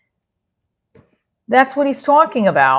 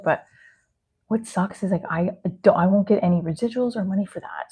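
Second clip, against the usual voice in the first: half whisper; 5 dB quieter than usual. Real, not acted. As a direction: talk softly as if sharing a secret. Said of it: "I don't know why I'm whispering".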